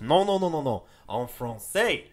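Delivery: imitating a French teacher, slow; French teacher correcting the class, mock accent. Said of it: "the French teacher goes".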